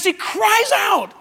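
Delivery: high, stretched shout; crying out. Shown as a demonstration. Preacher shocking the church with the man's outcry, shouted. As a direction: shout the words to act them out.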